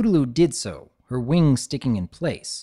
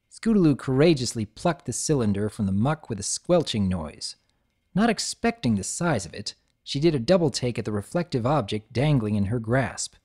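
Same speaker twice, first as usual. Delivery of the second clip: plain reading voice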